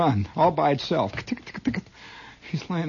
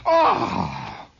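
dying cry; 12 semitones higher than usual. Retold or imitated. Squirrel dies.

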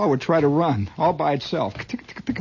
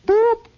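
whistle boop; 6 dB louder than usual. Retold or imitated. Imitated whistle.

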